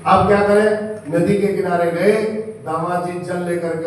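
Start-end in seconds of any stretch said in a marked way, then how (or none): 0.0-0.7 high, loud panicked cry. Acted. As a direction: blurt it out in sudden panic. Panic after merchant faints. (घबराई हुई ऊँची, तेज़ पुकार)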